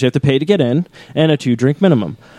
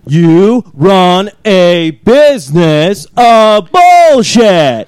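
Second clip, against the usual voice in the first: each word dragged out slowly, last word louder and higher; slow emphatic; 3 semitones higher, 5 dB louder, much slower than usual. Retold or imitated. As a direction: spell out "You run a business of bullshit" very slowly, punching "bullshit". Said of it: "I get down to his level".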